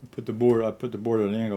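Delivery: normal talking voice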